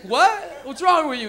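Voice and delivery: loud, high dad snapping at his son. Retold or imitated. Cop dad scolding his stoned son, loud. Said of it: "his dad's like".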